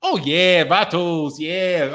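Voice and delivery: put-on Mexican-American homeboy accent; Chicano accent. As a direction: play a Chicano vato agreeing. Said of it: "when I do the accent".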